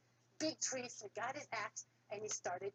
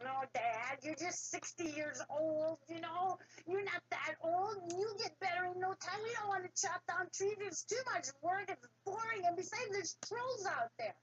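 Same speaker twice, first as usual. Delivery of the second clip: whining sons; 7 semitones higher than usual. Acted. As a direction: whine like lazy boys who won't work. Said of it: "they were whining".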